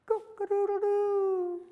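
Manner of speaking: very high, drawn-out crow; rooster crow. Acted. Preacher imitating the rooster crowing at Peter, very high.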